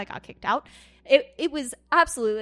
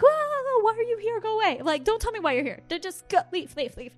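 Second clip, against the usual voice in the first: startled; 8 semitones higher than usual. Retold or imitated. To a spirit that appears, high startled shooing. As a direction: jump in fright and shoo it away.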